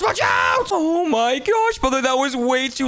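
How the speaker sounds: high yell at the bad driver; shouted warning. Warning brother driving badly.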